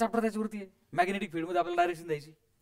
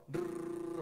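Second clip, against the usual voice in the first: rolled whirring noise; 4 semitones higher, 9 dB quieter, much slower than usual. Retold or imitated. Imitates rotation with a sound.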